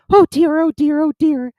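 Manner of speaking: high, loud, fretful little creature voice; Piglet. A narrator's reading. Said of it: "said Piglet to himself".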